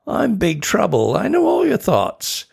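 rising high on thoughts, mildly gleeful; gleeful boast. Acted. Imp bragging to Tina, rising glee. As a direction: crow about it.

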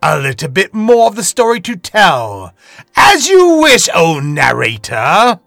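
loud, high, pompous aristocrat voice; grandiose lord. Acted. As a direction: indulge the narrator like a haughty noble. Lord Piotra answers the narrator.

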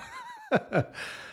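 high-pitched burst of laughter; real laugh. Real, not acted. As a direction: laugh at your own joke. Laughs at own joke.

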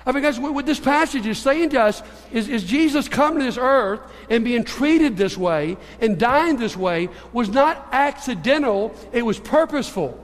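ordinary talk, nothing marked

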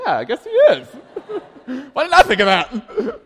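high, loud words breaking into laughter; amused dad cracking up mid-sentence; laughing. Real, not acted. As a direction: laugh through the line, caught off guard. Dad laughing at son's answer.

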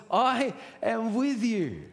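very drawn-out, high, emphatic promise; acted emphatic reassurance; God. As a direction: stretch the words out with insistence. God's emphatic answer to the excuses.

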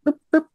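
very high-pitched imitated turkey cluck; two little high clucks; bubble cluck. Hunter clucking to make the gobbler look up, bubble cluck. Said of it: "where I bubble cluck".

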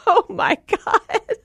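high, slow laughing through the words; laughing at her own embarrassing story. Real, not acted. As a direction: break up laughing as you say it. Laughing at the memory.

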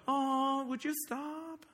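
mild mock plea. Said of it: "You don't go".

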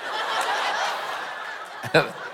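silent wheezing laugh at himself; helpless giggle; breathless laugh. Real, not acted. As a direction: laugh almost without voice. Laughs at own sign language.

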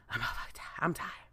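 sighed, whispered surrender; defeated whisper. Pretend. The rat voiced giving up, sighing defeated whisper. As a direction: give up in a whisper. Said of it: "the rat was like".

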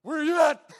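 high loud call-out to the enemy; combative challenge. Retold or imitated. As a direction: shout it as a challenge to a fight. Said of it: "I'm wearing armor like".

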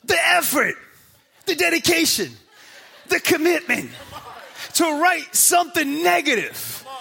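loud, high, hammering preacher build; preaching at full force. Acted. Pastor preaching hard to the church about reviewers, loud. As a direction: drive it home to the congregation, full throat.

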